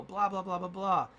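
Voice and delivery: mock rant. Filler for the ranting friend.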